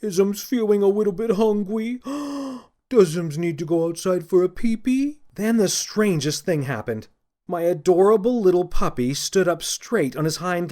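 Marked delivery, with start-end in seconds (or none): 0.0-5.2 put-on high baby-talk coo. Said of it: "I cooed to the puppy".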